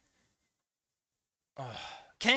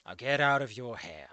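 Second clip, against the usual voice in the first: lower, softer huffy retort; with a huff; 10 semitones lower, 9 dB quieter than usual. Acted. Narrator reading the hero's huffy exit line. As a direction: huff it out, offended. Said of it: "I said with a huff".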